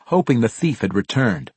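usual speaking voice

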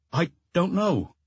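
schoolboy giving up on finding the words, slowly; hesitant repeat. A narrator's reading. Child repeats his answer to the inspector, hesitant and slow. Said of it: "He simply repeated".

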